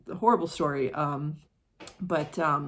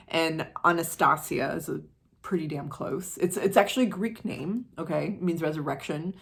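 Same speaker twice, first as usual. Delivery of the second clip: ordinary talk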